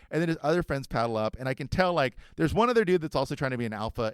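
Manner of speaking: ordinary talk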